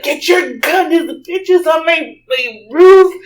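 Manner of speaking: high, loud acted panic; frantic yelling. Aunt begging husband for help against intruder, yelling. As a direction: build to a big cry on the last word.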